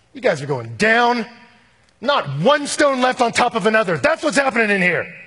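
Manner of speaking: preacher voicing Jesus as a loud, high denunciation; thundering warning. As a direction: boom out the doom over the city. Jesus warning Jerusalem, retold.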